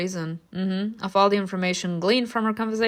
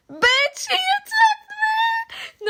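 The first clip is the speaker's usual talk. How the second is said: very high, wailing furious mother; shrieking accusation; much slower than usual. Acted. Mother accusing Simona, shrill and wailing. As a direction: screech it near tears.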